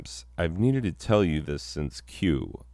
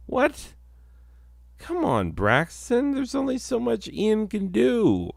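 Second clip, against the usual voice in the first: much higher, whiny come on; exasperated. Acted. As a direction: plead in a squeaky voice. Exasperated at Braxton.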